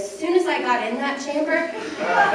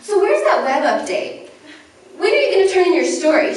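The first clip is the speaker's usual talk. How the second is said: higher, louder breezy editor, rushing at the end; mock cheery editor; 8 semitones higher, 4 dB louder than usual. Imitating her editor ignoring the apology, higher and brisk. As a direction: brush off the sorry and push for the story. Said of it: "And she basically says".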